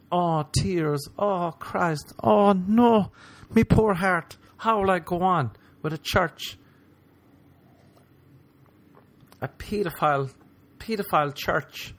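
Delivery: high, hammed-up wailing over the church; mock Instagram grief. Comic mocking fake online grief, high hammed-up wail. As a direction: ham up fake tears for a cathedral.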